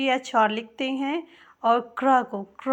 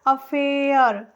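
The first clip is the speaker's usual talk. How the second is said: Affair stretched slowly, "a-fe-ar", opening on आ; slow repeat; much slower than usual. Shown as a demonstration. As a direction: slowly draw out affair to show the आ sound. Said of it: "आ की ध्वनि आ रही है ना". (Affair धीरे, आ की ध्वनि पर ज़ोर)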